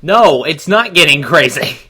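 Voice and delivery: ordinary talk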